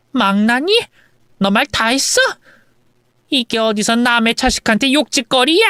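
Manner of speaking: lightly acted indignant older relative; affronted mother-in-law. Mother-in-law defending her son from insult, affronted. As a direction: take offence at the insult.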